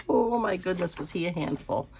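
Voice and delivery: usual speaking voice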